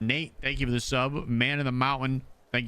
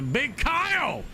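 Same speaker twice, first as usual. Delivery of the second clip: hype shout; 12 semitones higher than usual. Real, not acted. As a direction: stretch the name out like an announcer. Hype callout for gifted subs.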